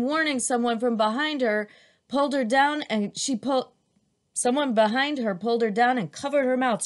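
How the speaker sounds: usual speaking voice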